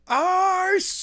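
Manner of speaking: very high anguished shout. A narrator's reading. Skylar in tears after the call, very high. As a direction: scream her name in despair. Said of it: "shouted Skylar".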